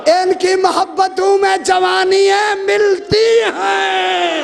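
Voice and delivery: full-force preaching. Acted. Preaching climax.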